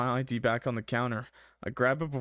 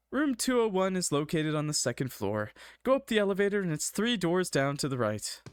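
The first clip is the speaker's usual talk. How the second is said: put-on higher receptionist voice; Pookie; 7 semitones higher than usual. A narrator's reading. Receptionist directing a visitor to a room, put-on higher voice. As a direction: pitch it up for giving directions. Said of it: "I'll just do the Pookie voice".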